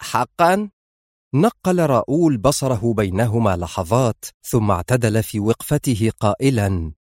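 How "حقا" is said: high, sharp questioning jab; sharp, disbelieving question; sharp challenge. A narrator's reading. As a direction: throw it back sharply, pitched high. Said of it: "قالت الوزيرة في حدة".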